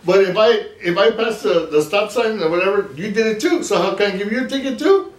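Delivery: high-pitched mock Cuban accent, arguing. TikTok guy arguing about a ticket, imitated Cuban accent. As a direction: imitate a Cuban-accented man pleading his case high. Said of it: "and he has an accent".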